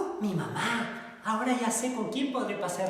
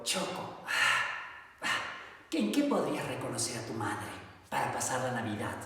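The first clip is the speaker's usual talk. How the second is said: soft smiling bear; 5 semitones lower, 4 dB quieter than usual. Acted. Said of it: "sonrió".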